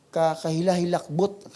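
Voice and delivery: ordinary delivery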